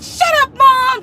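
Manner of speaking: high, loud bratty yell; kid yelling. Retold or imitated. Kid talking back to mom on YouTube, yelling. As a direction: shriek it at her mother. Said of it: "they be like".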